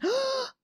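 sharp high gasp of surprise. Retold or imitated. Mom seeing the test, high gasp. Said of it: "she went".

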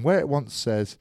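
high, slowed, exaggerated announcing voice; Alan Partridge impression. Telling a mate about the ref, pompous mock Partridge voice. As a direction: ham up a pompous presenter explaining. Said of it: "Alan Partridge walking into his meeting saying".

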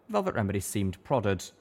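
ordinary delivery, nothing marked

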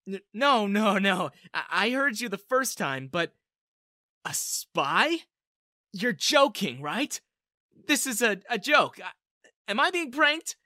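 flustered, incredulous questioning; stunned disbelief. A narrator's reading. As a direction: falter and restart, refusing to believe it. Stunned disbelief at spy confession.

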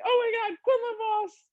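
high, excited fangirl gasp; high-pitched giddy recognition; starstruck squeal. Retold or imitated. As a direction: squeal the name like a starstruck fan. Starstruck fan recognizing a voice actor.

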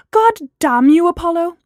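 loud, high exasperated outburst; angry cry. A narrator's reading. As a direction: curse him out loudly at a high pitch. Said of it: "Gemma cried".

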